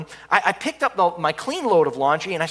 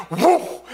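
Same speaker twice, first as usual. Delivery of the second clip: whoosh of flames; 7 semitones higher than usual. Retold or imitated. Fire flares up.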